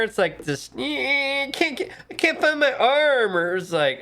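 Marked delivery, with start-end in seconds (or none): 0.8-3.4 put-on high whiny child struggling with shirt; whiny kid. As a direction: whine like a kid stuck in a shirt. Kid stalling while dressing.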